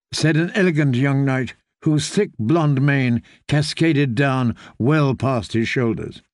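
usual speaking voice

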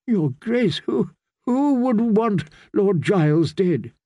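disbelieving, tripping over his words; stammering old maester. Acted. Old maester stunned by the queen's suspicion, stammering.

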